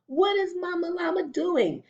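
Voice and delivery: ordinary delivery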